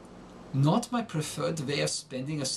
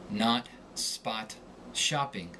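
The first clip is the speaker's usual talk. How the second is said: American short O like "ah", set lower; American accent; 4 semitones lower, slower than usual. Shown as a demonstration. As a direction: say "not, spot, shopping" with open American "ah". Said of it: "Within the confines of the American accent".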